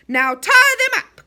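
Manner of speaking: slow, loud and high order; stern command. Acted. Enchanter commanding his imps, slow and loud. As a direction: lay down the command. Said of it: "commanded the enchanter".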